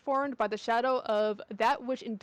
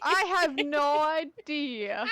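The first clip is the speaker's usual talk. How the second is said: sarcastic; 4 semitones higher, much slower than usual. Acted. Slow sarcastic reply.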